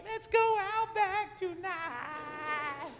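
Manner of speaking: high, slow victory cry; high, drawn-out celebration shout; triumphant stretched-out call. Real, not acted. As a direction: draw it out like a champion. Triumphant fist pump.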